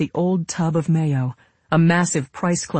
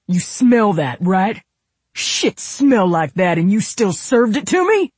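loud, high, angry accusation; outraged, rising to a yell; screaming; 5 semitones higher, 5 dB louder than usual. A narrator's reading. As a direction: rant at the one who served it. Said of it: "Royal was screaming about mayonnaise".

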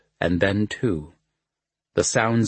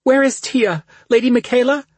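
tearful pleading; 7 dB louder than usual. Acted. Princess weeping in fear.

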